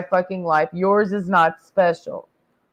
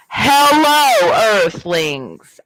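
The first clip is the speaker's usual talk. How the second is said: megaphone announcement; 4 dB louder, much slower than usual. Pretend. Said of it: "I need Earth's megaphone".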